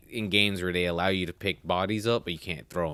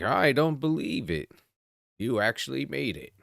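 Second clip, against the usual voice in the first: mock disbelief; 7 semitones higher than usual. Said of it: "this guy sitting there is like".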